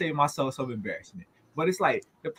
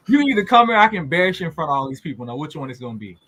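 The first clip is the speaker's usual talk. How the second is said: raised, rapid-fire retold ultimatum; stern parental warning; 6 dB louder, faster than usual. Dad warning his pouting son at a football game, stern retold ultimatum. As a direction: lay down the choice fast and loud.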